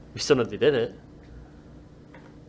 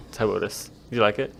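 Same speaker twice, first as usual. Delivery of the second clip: usual speaking voice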